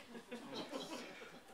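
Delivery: soft airy giggle; high laugh. Real, not acted. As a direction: laugh quietly. Laugh in scene.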